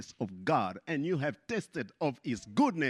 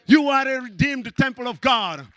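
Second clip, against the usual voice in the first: said higher and louder; loud proclamation; 7 semitones higher, 7 dB louder than usual. Shown as a demonstration. Preacher proclaiming to believers, loud. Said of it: "repeated line".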